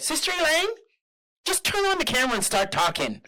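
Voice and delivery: high-pitched, bossy quoted voice; imitated urging woman. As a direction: call it out high, imitating an insistent friend. Voicing woman who urged her.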